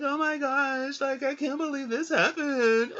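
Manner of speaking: high-pitched hammed-up wailing; mock weeping. Woman mourning at the man's coffin, mock weeping. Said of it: "crying and weeping".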